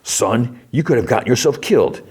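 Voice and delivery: imitating a jerk father's reprimand; Leroy. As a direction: scold your son, exaggerated. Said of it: "what does Jerko Leroy say".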